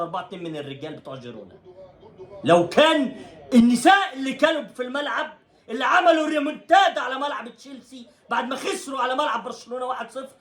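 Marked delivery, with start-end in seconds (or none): 2.4-10.3 much higher, shouted outrage with laughs; heated rant. Real, not acted. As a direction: shout it out in disbelief. Ranting about women's team. (صراخ غاضب ساخر عن الفريق)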